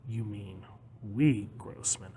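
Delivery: slow, hushed pointed correction; quiet menace. Acted. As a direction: drop low and slow, let it threaten. Menacing correction of business partner.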